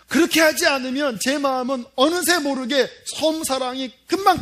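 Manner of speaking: loud, emphatic sermon delivery; impassioned preaching. Real, not acted. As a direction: preach it with urgent conviction. Preaches with rising force. (힘주어 외치는 설교)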